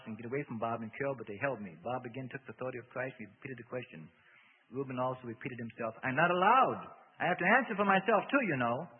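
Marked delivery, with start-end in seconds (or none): 6.0-8.9 loud, high outburst; agitated protest. A narrator's reading. Demon tells Bob it is not allowed, agitated. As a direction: protest hard against the questioning.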